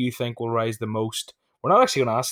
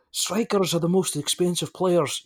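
higher, slow mock Scottish accent; 7 semitones higher, slower than usual. Quoting Souness on strikers to the lads, in a mock Scottish accent. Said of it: "You're gonna love my Scottish accent".

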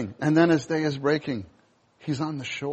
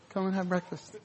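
softer, gentle invite; tender invitation; 5 semitones higher, 9 dB quieter than usual. Retold or imitated. Jesus inviting the failed disciples to eat, tender. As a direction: welcome them kindly, no blame. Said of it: "Do you see his heart?".

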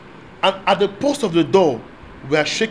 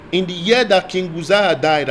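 ordinary delivery

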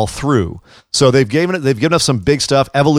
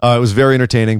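ordinary talk

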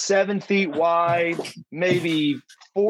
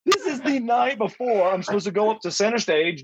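higher-pitched, worked-up mock pleading; pleading protest; 4 semitones higher than usual. Husband begging wife to see sense before debut, pleading protest. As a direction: act out a panicky case against it.

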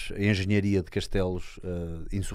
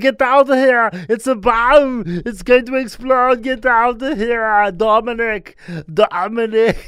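loud, much higher hammed-up action-hero yell in English; Arnold action hero; 13 semitones higher, 10 dB louder, much slower than usual. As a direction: shout orders like a movie hero evacuating kids. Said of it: "parecia o Arnold naquele filme". (imitação exagerada do Arnold a gritar em inglês)

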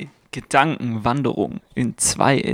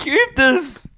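exasperated; 13 semitones higher, slower than usual. Real, not acted. Frustrated at constant interruptions.

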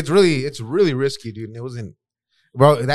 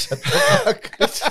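laughing. Real, not acted. Bursts out laughing.

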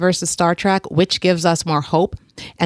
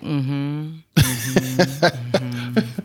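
bored mm-hmm; 3 semitones lower, 4 dB quieter, much slower than usual. Retold or imitated. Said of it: "And I'm over here like".

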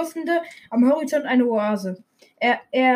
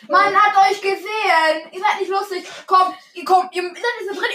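high, raised voice to the intruders; calling out; 6 semitones higher, 4 dB louder than usual. Real, not acted. Podcaster to siblings caught at the door, calling out. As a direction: call them over, pitched up and insistent.

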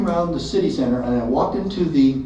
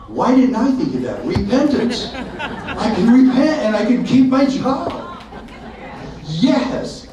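excited realization; 9 semitones higher, slower than usual. Real, not acted. Realization, laughing relief.

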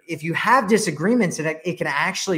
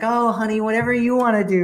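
higher, fast, syrupy agreeable voice; mock sugary "whatever you want", pitched up; mock sweet wife; 7 semitones higher, 5 dB louder, faster than usual. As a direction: play the too-easygoing girlfriend. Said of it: "women being like".

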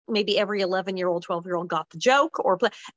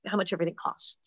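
ordinary delivery

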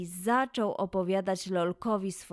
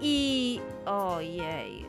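overwhelmed; 3 semitones lower, much slower than usual. A narrator's reading. Narrator loses track of list.

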